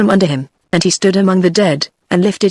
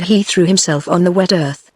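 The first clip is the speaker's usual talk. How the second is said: ordinary delivery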